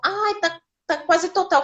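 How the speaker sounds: a doctor caught off guard; surprised doctor. Retold or imitated.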